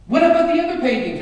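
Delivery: high, loud, fast complaint; grousing outcry. Acted. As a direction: protest the end of the auction. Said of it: "groused and grumbled".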